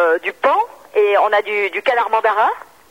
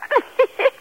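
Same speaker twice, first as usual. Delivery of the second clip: high laugh. Real, not acted. Laughs at the pun.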